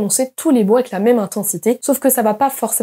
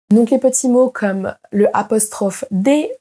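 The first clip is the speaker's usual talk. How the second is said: ordinary talk, nothing marked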